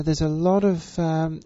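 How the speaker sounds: usual speaking voice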